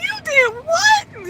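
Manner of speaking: very high, drawn-out loud shriek; re-enacted scream at a friend's news; screamed disbelief. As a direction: squeal it slowly in total shock. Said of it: "literally screamed yesterday".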